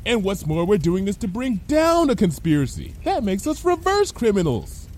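high-pitched cheerful reasoning; high, unbothered character voice; Lyra, self-assured. Acted. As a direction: argue it with blithe confidence.

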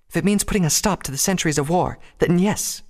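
high, earnest feminine voice; high-pitched, determined answer; resolute princess. A narrator's reading. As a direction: stand your ground in a high voice. Princess argues with her brother.

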